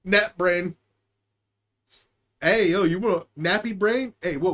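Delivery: normal talking voice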